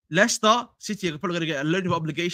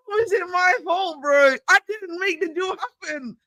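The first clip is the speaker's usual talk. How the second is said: mock indignant protest; 9 semitones higher than usual. Pretending to protest the blame.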